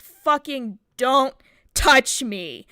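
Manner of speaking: slow, loud, word-by-word warning; forceful, spaced-out retelling of fury; retold angry warning. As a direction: re-enact snapping at someone grabbing your arm. Retold snap at teacher grabbing her arm.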